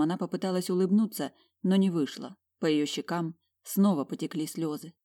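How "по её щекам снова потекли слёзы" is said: ordinary delivery, nothing marked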